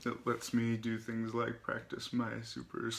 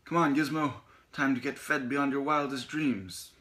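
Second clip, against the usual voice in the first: high, loud rallying call; rally your little companion in triumph; triumphant cry; 6 semitones higher, 7 dB louder than usual. A narrator's reading. Otto to his mouse with oats at hand, triumphant cry. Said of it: "emerged with a triumphant cry".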